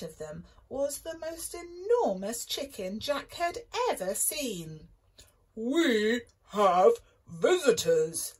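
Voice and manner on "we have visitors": slow loud booming giant. Acted. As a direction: boom it out like a giant. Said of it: "boomed the giant".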